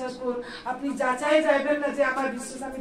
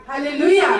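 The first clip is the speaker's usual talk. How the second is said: called hallelujah; 8 dB louder than usual. Real, not acted. Preacher calls to congregation.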